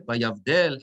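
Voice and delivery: "Vayavdel" accented on its "del"; stress on last syllable. Shown as a demonstration. Teacher repeating the accent on the dalet, "Vayavdel" stressed on "del". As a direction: land the weight on "del" in "Vayavdel". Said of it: "the accent is on the dalet".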